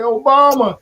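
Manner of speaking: excited exclamation. Retold or imitated. Telling the crowd why he cried.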